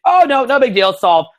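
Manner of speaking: high, loud breezy dismissal; mock-carefree, pitched well up; making it look easy. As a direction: toss it off as if effortless. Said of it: "we wanna make it look easy".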